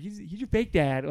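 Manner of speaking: high, whiny put-down; hammed-up teenage scorn; mock exasperation. God dismissing the false god to Israel, exasperated high voice. Still voicing God's scornful protest.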